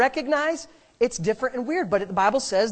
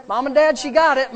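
usual speaking voice

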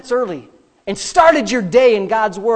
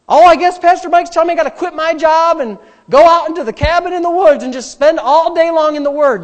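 high, loud mock complaint; devil's exaggeration; 8 semitones higher, 8 dB louder than usual. Devil twisting pastor's advice, exaggerated mock protest. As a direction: blow it up into indignant overreaction. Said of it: "he goes to the extreme and says".

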